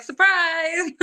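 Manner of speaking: high sing-out reveal; playful surprise. Retold or imitated. Pregnant student telling her professor, high playful surprise. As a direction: announce it brightly and high, with mock fanfare.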